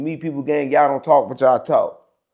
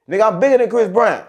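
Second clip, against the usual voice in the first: boastful; 8 semitones higher, 4 dB louder than usual. Real, not acted. Boasting, pitch jumps up.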